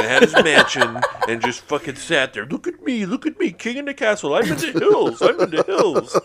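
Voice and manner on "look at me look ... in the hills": high, gloating mock king; boastful king voice. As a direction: crow like a smug little king. Voicing Howie, pitched up high.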